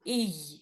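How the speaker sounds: ई said alone, low and soft, to show the joined sound; isolated vowel. Shown as a demonstration. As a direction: model just the ई sound, low and quiet. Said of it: "ऐसा". (अलग से ई की आवाज़)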